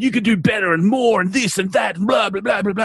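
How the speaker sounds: fed-up mock cheerleading; mocking exasperation. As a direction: parrot the quotes with a sneer. Mocking motivational quotes.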